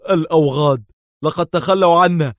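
high, loud angry outburst. A narrator's reading. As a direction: spit it out furious. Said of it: "قال دار في غضب".

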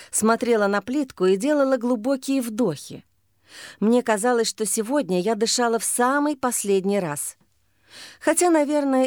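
usual speaking voice

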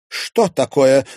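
loud, high alarmed question; a flustered, worried cry; a museum director alarmed at the video; agitated. A narrator's reading. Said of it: "разволновалась Алёна Александровна".

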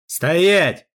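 sharp barked command to halt; shouting. Acted. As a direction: shout the order at him. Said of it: "крикнул мне один из них".

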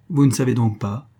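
ordinary delivery, nothing marked

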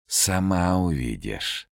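slow, otherworldly voice; eerie castle voice. A narrator's reading. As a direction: speak like the haunted castle. Голос замка отвечает Ольге. (потусторонний медленный голос)

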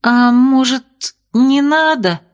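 high pleading whimper; plaintive. A narrator's reading. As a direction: beg pitifully. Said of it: "Жалобно".

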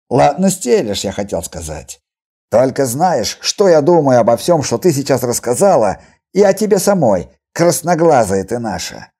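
loud, needling confrontation; aggressive taunt. Acted. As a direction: lay into her with mocking menace. Healer insultingly confronts mutant girl. (громкий издевательский наезд)

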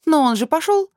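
high, loud scoffing retort; dismissive snort. A narrator's reading. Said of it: "фыркает Аннабель".

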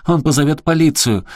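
громкий панический крик, выше; кричи в панике. Acted. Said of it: "впала в панику, закричала". (Аляска в панике кричит Эрику)

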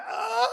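loud, high comic shriek; high exclaimed "ah". Acted. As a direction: yelp out a mock reaction. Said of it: "Her chiches went".

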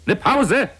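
schrill hoch und laut gekreischt; ungläubig aufkreischen; kreischend. A narrator's reading. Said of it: "kreischte ich". (laut kreischende Frage)

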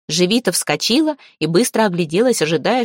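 usual speaking voice

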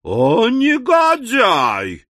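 high, very drawn-out shriek of indignation; outraged cry. A narrator's reading. Oldest teacher outraged at being called dear, shrieking. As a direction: wail the insult slowly, scandalized.

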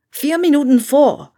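loud call back over the shoulder while running. A narrator's reading. Harry running down the stairs calls back to Hermine, loud. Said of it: "rief er zurück".

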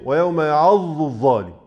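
ويوم يعض الظالم with ض turned into ظ; imitating the merging error; both made zaa. Shown as a demonstration. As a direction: say يعض like يعظ before الظالم. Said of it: "الاتنين ظاء".

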